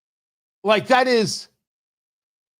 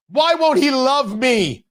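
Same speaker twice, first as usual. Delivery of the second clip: slow, whiny lovesick mockery; mock jilted lover; 5 semitones higher, slower than usual. Host mocks congresswoman as jilted lover, whining slowly. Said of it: "How dare he".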